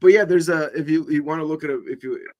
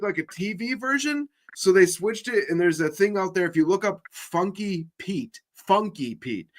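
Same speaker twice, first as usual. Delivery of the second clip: usual speaking voice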